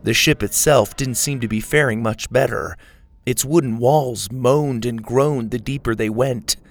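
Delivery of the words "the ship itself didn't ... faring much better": ordinary delivery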